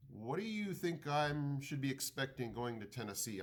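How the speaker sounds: normal talking voice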